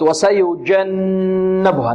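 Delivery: وسيجنبها with the doubled n hummed long through the nose; slow recitation, lingering on the ن of وسيجنبها; held ghunnah. Shown as a demonstration. Teacher showing ghunnah to students, long nasal n. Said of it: "النون غنة".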